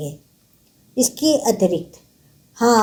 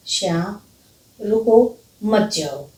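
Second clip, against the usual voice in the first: slow, pause after रुको, then मत जाओ together; slower than usual. Shown as a demonstration. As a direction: break after रुको as if at a comma. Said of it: "रुको के बाद अगर मैं कॉमा लगाती हूँ".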